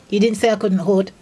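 usual speaking voice

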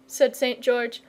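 usual speaking voice

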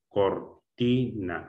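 "Cortina" slowly split as cor-ti-na; syllable by syllable. Shown as a demonstration. Teacher testing the stressed syllable, syllable by syllable. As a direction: pause between the syllables of "Cortina". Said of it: "la sílaba tónica".

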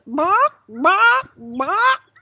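three loud, high rising clucks; high-pitched "bawk, bawk, bawk"; chicken squawks. Acted. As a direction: cluck three times like an insistent hen. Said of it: "the chicken sets the three books down".

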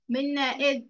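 ordinary talk, nothing marked

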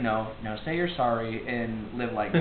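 low, soft, slow mock-saintly voice; calm counsel. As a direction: gently advise forgiveness. Acted Jesus calmly counselling forgiveness.

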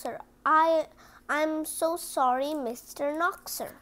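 ordinary delivery, nothing marked

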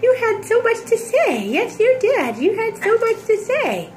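high sing-song cooing, lightly teasing. Real, not acted. Mother praising her babbling baby, high cooing baby talk. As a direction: coo it up high like to an infant.

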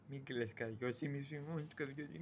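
low, very soft soothing; soft consoling. Acted. Consoling the unpaid servant Karagiozis, softly and low. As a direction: hush it low, like comfort.

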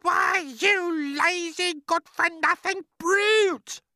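slow, piercing high shout; angry shout. A narrator's reading. Aunt Spiker abusing James for asking, shouting. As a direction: shout each insult high and drawn out. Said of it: "Aunt Spiker shouted".

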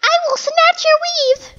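high, drawn-out playful threat; mock threat. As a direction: squeal a slow mock threat.

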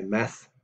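"Math" said alone as a model word; pronunciation demo. Shown as a demonstration. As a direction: show how "Math" sounds, ending on a th. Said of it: "is how that's pronounced".

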